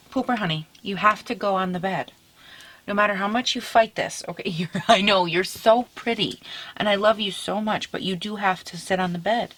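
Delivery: soft, affectionate pet talk; tender coaxing. Real, not acted. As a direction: gently reason with a beloved dog. Coaxing her dog gently.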